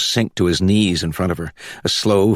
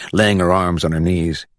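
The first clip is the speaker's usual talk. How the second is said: ordinary delivery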